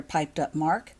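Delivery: plain reading voice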